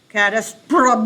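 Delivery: ordinary delivery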